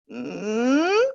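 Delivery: creaky mm gliding upward; rising fry-to-hum creak; squeaky door hum. Shown as a demonstration. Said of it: "almost think like a squeaky door".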